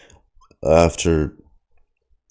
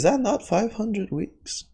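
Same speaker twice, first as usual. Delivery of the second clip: incredulous, high-pitched; 4 dB quieter than usual. Real, not acted. Disbelief at the long grind.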